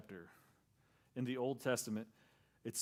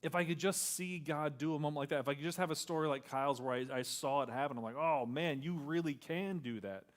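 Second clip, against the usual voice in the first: loud, higher, driving sermon voice; full-force preaching; 4 semitones higher, 6 dB louder than usual. Acted. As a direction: preach it out to the whole room.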